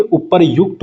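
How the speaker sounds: "उपरयुक्त" as upar-yukt; correct form demonstrated. Shown as a demonstration. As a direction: sound the yu in "उपरयुक्त". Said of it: "उपरयुक्त होता है".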